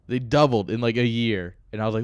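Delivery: usual speaking voice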